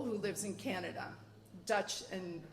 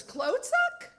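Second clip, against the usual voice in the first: high, loud, puzzled repeat; surprised echo; 8 dB louder than usual. Retold or imitated. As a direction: bounce the word back, puzzled and higher. Sister repeats word, surprised.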